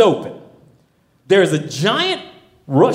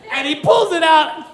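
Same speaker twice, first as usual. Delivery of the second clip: ordinary delivery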